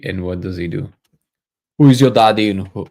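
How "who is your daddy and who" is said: put-on loud, botched Austrian accent; Arnold Schwarzenegger. Streamer fumbles a film quote, loud mock Austrian accent. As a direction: belt out a failed attempt at an Austrian accent. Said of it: "no, that's not Arnold".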